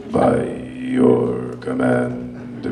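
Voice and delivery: very slow, low monotone; mock robotic command.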